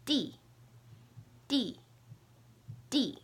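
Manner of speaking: "D" as "dee", short and falling sharply from high; fourth tone. Shown as a demonstration. Teacher drilling while learner tries, falling "dee". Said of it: "you can hear the difference".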